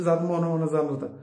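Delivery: ordinary delivery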